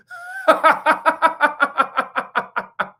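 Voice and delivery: high, loud mocking cackle; mocking laugh. Imitating the saleswoman laughing at him, high and loud. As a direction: cackle like she's laughing at you. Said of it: "She went".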